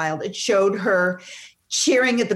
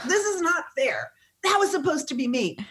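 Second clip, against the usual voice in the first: high-pitched plaintive protest; mad at God; 9 semitones higher than usual. Retold or imitated. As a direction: imitate railing at heaven over unfairness. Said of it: "I'm mad at God".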